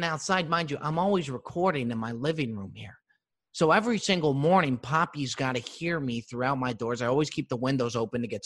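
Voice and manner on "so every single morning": ordinary delivery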